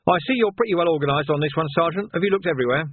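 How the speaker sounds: well-meaning captain checking on the search; fussy captain. Acted.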